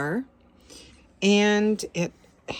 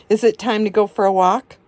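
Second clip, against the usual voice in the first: fast, louder, singsong question to the dog; pet-talk excitement; 7 dB louder, faster than usual. Real, not acted. Asking her dog if it is walk time, excited and fast. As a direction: hype the dog up.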